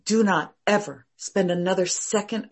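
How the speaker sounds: usual speaking voice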